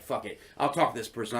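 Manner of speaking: low, soft aside; muttered to self. Retold or imitated. Deciding to talk to a stranger, muttered quietly. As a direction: say it under your breath. Retold inner decision, quiet.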